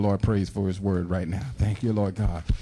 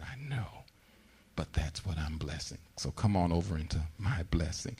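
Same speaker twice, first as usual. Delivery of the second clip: soft, hushed reply, almost a whisper; gentle voice of God; 4 semitones lower, 9 dB quieter than usual. Retold or imitated. Preacher voicing God gently inviting the doubter into his blessing, hushed and soft. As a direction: answer softly, inviting them in.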